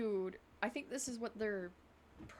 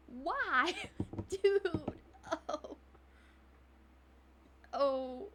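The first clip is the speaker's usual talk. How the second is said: exasperated laughing yell; whisper yelling; 8 semitones higher, 7 dB louder, much slower than usual. Real, not acted. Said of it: "whisper yell".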